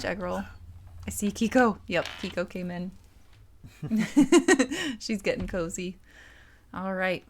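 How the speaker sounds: normal talking voice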